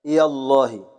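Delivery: يا الله said with the ya opened to ya; ya with fatha. Shown as a demonstration. Teacher demonstrating the second dialect form. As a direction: open the ya in يا الله. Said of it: "فتح الياء".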